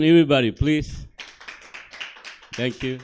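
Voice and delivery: ordinary delivery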